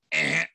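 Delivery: abrupt wrong-answer buzzer; mock buzzer noise. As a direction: imitate a game-show buzzer rejecting an answer. Imitates game buzzer rejecting answer.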